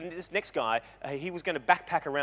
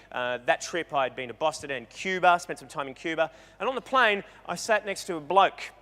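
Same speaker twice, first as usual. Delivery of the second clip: ordinary talk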